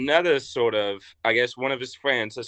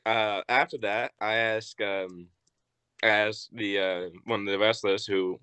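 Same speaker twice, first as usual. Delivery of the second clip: usual speaking voice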